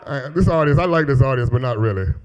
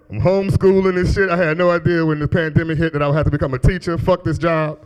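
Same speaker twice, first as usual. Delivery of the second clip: normal talking voice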